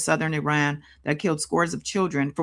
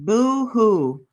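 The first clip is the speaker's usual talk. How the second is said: sarcastic fake crying, higher and louder; mock the crying man; mocking boo hoo; 5 semitones higher, 5 dB louder than usual.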